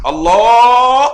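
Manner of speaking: a sharp, loud cry of Allah, high and startled; sudden loud exclamation. Retold or imitated. Preacher retelling the sheikh's interruption, loud startled cry. Sheikh taps mid-prayer.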